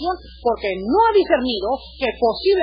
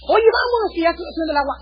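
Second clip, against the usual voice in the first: mock calling out; 6 semitones higher, 5 dB louder than usual. Sailors call to stranded man.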